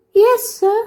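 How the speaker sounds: put-on high girl's voice, drawn out; girl answering slowly. A narrator's reading. Anne answering her schoolmaster, slow and reluctant. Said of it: "said Anne slowly".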